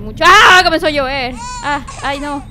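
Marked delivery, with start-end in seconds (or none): 0.3-0.6 high loud scream; real surprise yelp; startled scream. Real, not acted. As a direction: cry out in surprise. Rain suddenly starts.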